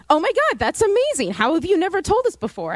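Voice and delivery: high-pitched excited gushing, easing down; amazed reaction. Retold or imitated. Coworkers react to learning she's a triplet, amazed. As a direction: imitate astonished coworkers.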